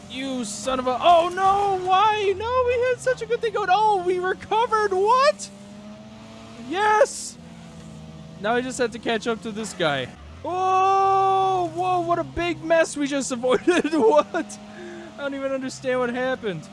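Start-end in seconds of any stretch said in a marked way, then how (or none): 0.1-7.4 shrieking in disbelief; panicked high shriek; high-pitched panic. Real, not acted. Chaos in the race. 10.4-16.7 breathless high disbelief; high-pitched amazed shriek. Real, not acted. Narrowly avoiding a pile-up.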